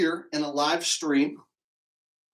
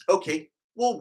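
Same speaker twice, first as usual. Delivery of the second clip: normal talking voice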